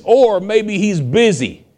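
high, slow, sing-song mockery; mocking taunt. Elijah taunting the prophets of Baal, high mocking voice. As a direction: jeer at them like a smug rival. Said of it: "Elijah taunts them".

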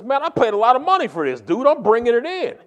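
defiant. Retold or imitated. Retold customs argument, defiant traveller.

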